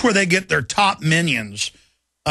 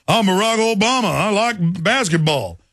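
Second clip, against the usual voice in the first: mocking Obama to blacks; 3 semitones higher than usual. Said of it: "he's like".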